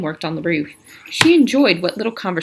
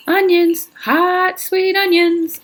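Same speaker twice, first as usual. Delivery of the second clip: a street vendor's call, much higher and louder; 12 semitones higher, 6 dB louder, slower than usual. Acted. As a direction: hawk the onions down the street. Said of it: "Sam called out on the street".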